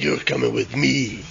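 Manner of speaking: a hint of a sinister ghost; menacing ghost. Ghost threatening the boy, low and eerie. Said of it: "And she said".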